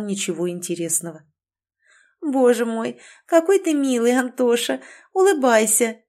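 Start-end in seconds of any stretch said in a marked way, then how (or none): 2.2-6.0 put-on high, loud drunk woman gushing; tipsy gushing. A narrator's reading. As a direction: squeal it like a tipsy flirt.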